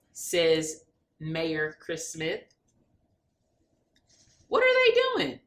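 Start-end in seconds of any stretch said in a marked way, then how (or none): 4.5-5.4 very high, bright puppy voice; curious dog voice. Acted. Sparky the dog asking about the swearing-in, high puppy voice. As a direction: squeak it like a small puzzled dog. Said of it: "says Sparky".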